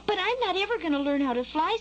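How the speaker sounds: high little bird stating a fact; childlike, simply certain; matter-of-fact. A narrator's reading. Skylark telling the worm he won't fly, matter-of-fact. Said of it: "said Skylark matter-of-factly".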